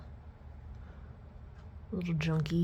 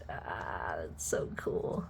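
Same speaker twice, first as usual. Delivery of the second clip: whisper; 13 semitones lower, 7 dB quieter, much slower than usual. Real, not acted. Awed at the yo-yo sliding.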